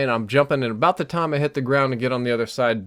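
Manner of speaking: usual speaking voice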